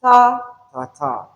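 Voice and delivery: first "ta" loud, the next two weak; strong-weak-weak beat. Shown as a demonstration. Teacher beats out the stress pattern, strong then weak. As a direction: punch the first "ta", let the others drop. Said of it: "The first one is strong".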